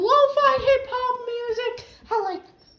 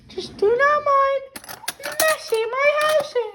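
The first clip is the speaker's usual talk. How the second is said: put-on voice pitched well up; bright high vlog voice; 3 semitones higher than usual. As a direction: chirp it up high. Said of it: "using this high-pitched voice".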